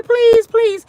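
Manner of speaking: hammed-up desperate please; mock pleading. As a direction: beg like a scared victim, mocking it. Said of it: "the more you crying".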